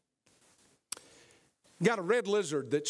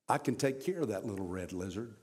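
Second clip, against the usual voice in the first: lower, quicker angel voice; a lightly voiced angel, low and brisk; calm angel; 8 semitones lower, 4 dB quieter, faster than usual. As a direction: speak as a quiet angel with calm assurance. Angel speaks in retold story.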